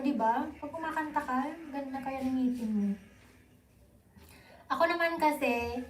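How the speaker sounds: ordinary talk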